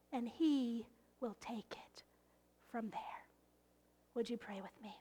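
soft, quick intimate whisper; hushed whisper. Acted. Pastor inviting the congregation to pray, whispered. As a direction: draw them in with a hush.

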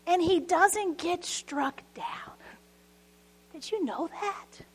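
slow whispered aside to the congregation; hushed whisper. Real, not acted. Preacher whispering a surprising truth to listeners, hushed and slow. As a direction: lean in and whisper it. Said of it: "unvoiced whisper aside".

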